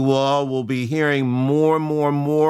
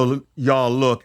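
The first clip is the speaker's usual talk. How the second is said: usual speaking voice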